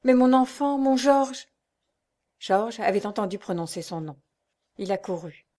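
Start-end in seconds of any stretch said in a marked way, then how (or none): 0.0-1.5 high, louder anguished cry; narrated mother's tearful wail, voiced high; sobbing exclamation. A narrator's reading. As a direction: cry out for your child. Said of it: "s'écria la jeune veuve en sanglotant".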